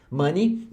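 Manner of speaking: "money" repeated as "MUH-nee"; correct money pronunciation. Shown as a demonstration. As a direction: model "money" with an "uh" then a short "ee". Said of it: "você fala money". ("money" repetido certo, como "mãni")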